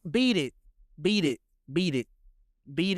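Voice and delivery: stubborn spoken lyrics; deadpan reading. Pretend. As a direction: beat it read flat, one word each, no tune. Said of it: "That ain't how he sang it".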